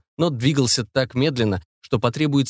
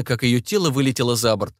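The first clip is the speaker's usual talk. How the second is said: ordinary delivery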